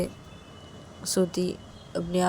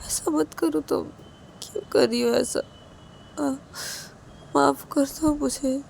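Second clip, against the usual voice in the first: tearful pleading; 6 semitones higher than usual. A narrator's reading. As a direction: plead through tears, voice wavering high. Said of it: "Siya की आंखों से आंसू गिरने लगते हैं". (रोते हुए गिड़गिड़ाना)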